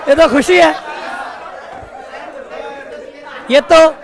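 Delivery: teasing shout at the class; joking shout. Real, not acted. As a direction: shout it out as a playful retort. Teacher exclaims bribe is joy.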